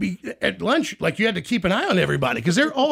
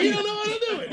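much higher squeaky taunt, softer; high mocking boss; 14 semitones higher, 4 dB quieter, faster than usual. Bob sticking his head out of his office, high mocking taunt. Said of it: "Stick his head out".

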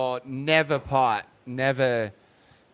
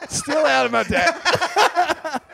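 laughing; 13 semitones higher, 5 dB louder, much slower than usual. Real, not acted. Laughing self-mockery.

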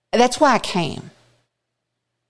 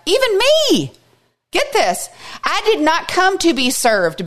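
urgent Jesus voice; 9 semitones higher than usual. Acted. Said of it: "Jesus is like".

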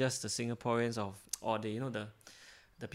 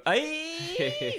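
mock ceremonial.

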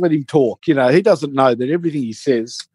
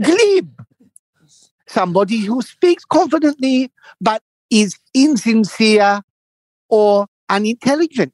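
high, slow definition; mock lecture; mimicking him reciting; 6 semitones higher, slower than usual. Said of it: "He goes".

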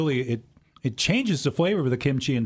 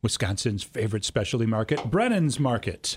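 radio announcer read; 5 semitones higher than usual. Acted.

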